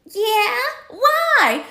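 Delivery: put-on high-pitched innocent little kid; child's voice. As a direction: answer mama in a small high voice. Child answering mom, in retold story.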